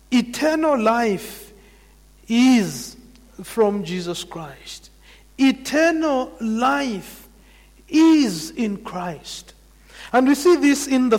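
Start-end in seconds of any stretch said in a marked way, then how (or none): 0.1-9.5 drawn out, every word weighed; slow it right down to land the point; preacher driving home his point, slow and deliberate; slow emphatic restatement. Acted.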